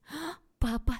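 high, loud startled exclamation; alarmed. A narrator's reading. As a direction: gasp it out in sudden alarm. Father suddenly comes home, girl alarmed.

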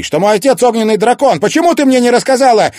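high, very loud demand; accusing shout. A narrator's reading. Son demanding the truth from his mother, shouting. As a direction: shout it in outrage.

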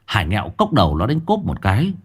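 usual speaking voice

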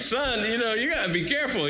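höher gesetzte Papa-Stimme, mahnend; fatherly warning. Retold or imitated. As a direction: warn the son like a worried dad. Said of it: "And I'm like".

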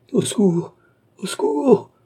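high, loud cry of alarm; crying for help. A narrator's reading. Young woman fleeing into her apartment, screaming for help. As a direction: call out shrilly, rising on the repeat. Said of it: "cria André".

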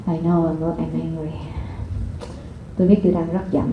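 calmly naming her own anger; inner self-talk. Shown as a demonstration. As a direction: say it quietly to yourself. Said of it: "Liên nói trong đầu".

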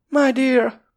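high, loud shocked yelp; My dear cried out in alarm; wailing cry. A narrator's reading. Missus Boyd cries out at the quarrel, high and loud. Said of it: "jajdul fel Missus Boyd".

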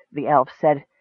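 ordinary delivery, nothing marked

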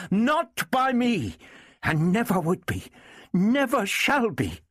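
high, indignant insistence; agitated fury. A narrator's reading. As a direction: draw out each denial in a high voice. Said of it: "expressing her agitation and fury".

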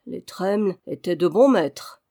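plain reading voice

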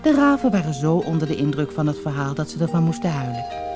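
ordinary delivery, nothing marked

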